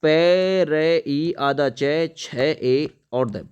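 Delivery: letters dictated very slowly; slow spelling out. Shown as a demonstration. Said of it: "देखिए परिच्छेद किस type से". (धीरे-धीरे अक्षर बोलते हुए)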